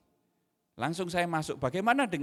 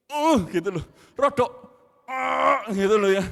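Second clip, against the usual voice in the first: loud, higher and strained; exasperated shout; 6 semitones higher, 10 dB louder than usual. Retold or imitated. Father pushing his son harder, shouting loudly. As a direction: shout it out in frustration.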